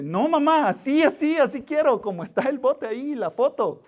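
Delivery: put-on high, pleading child's voice insisting to mom; whiny little boy. A child begging his mother over a hot dog, whiny and high. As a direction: play a small boy demanding it be done right. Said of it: "Y el niño dice".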